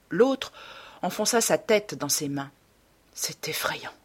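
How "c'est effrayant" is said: soft shuddering whisper; hushed, whispered dread; horrified murmur. A narrator's reading. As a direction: murmur it almost without voice, appalled. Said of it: "murmura-t-il tandis qu'un frisson le parcourait".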